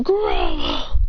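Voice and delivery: very low growled monster cry; deep acted roar; creature roar. A narrator's reading. Graveler roars its name.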